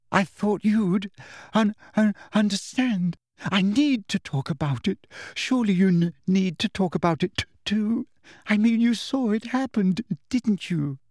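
put-on high, teary, stammering girl voice; tearful stammer. A narrator's reading. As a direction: choke up on the words, high. Cho crying, stammering.

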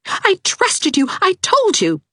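high, loud, near-hysterical mother; a frantic mother accusing the teacher; shrill, loud cry of an overwrought mother; hysterical lady. A narrator's reading. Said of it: "almost hysterical lady".

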